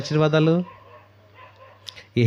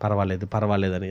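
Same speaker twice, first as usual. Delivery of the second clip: devil's coaxing; 7 semitones lower, 6 dB quieter than usual. Retold or imitated. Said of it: "ప్రేరేపిస్తుంది".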